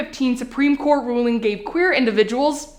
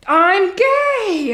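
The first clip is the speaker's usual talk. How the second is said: announcement; 7 semitones higher than usual. Acted. Daughter's big announcement, acted.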